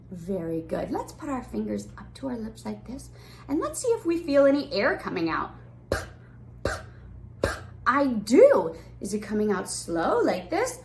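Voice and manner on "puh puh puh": voiceless little puffs of air; puffing P sound. Shown as a demonstration. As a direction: pop the lips on each "puh". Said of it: "like a puffing sound".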